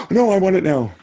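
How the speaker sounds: high, loud pretend pleading; mock urgent protest. Reader whose book is being taken back, mock urgent protest. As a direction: cry out as if it's being snatched away.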